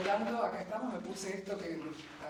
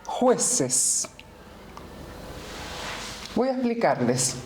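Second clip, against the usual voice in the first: Clytemnestra addressing her judges; 2 semitones lower, 10 dB louder than usual. Acted. Accused murderess addresses her judges, theatrical and deliberate.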